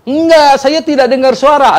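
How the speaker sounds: preacher getting angry at the question, loud and indignant; angry denial. Retold or imitated. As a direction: snap back, offended. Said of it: "malah dia marah".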